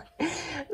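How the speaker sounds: short, quiet breathy chuckle; a light amused laugh; soft laugh. Real, not acted. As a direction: laugh softly to yourself. Laughs at her own remark.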